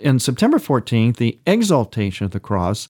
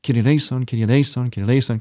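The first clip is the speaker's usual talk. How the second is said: quick Kyrie eleison, each propelled into the next; rapid, first-syllable emphasis; much faster than usual. Shown as a demonstration. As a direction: hit the first syllable and run the rest together. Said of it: "an emphasis on the first syllable".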